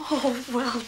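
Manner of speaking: ordinary talk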